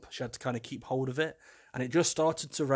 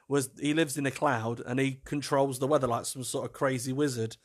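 ordinary talk, nothing marked

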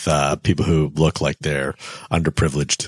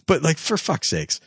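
normal talking voice

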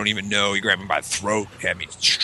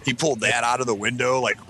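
ordinary talk, nothing marked

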